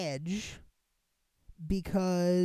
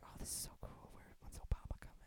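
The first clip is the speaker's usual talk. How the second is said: hushed, breathless whisper of excitement; whispered inner thought; 16 dB quieter, faster than usual. Retold or imitated. Waiting at the window for Obama, whispering eagerly.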